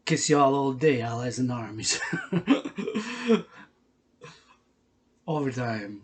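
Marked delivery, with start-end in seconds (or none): 2.0-3.4 high amused laugh; short high laugh. Real, not acted. Laughing after blowing kisses.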